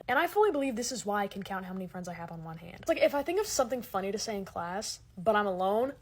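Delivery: usual speaking voice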